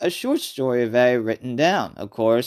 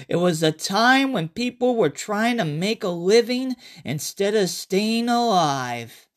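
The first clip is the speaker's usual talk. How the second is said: higher, brighter-pitched storyteller voice greeting the listener; character narrator; 7 semitones higher than usual. Acted. Survivor greeting listeners before his flashback story. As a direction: play a survivor telling his tale.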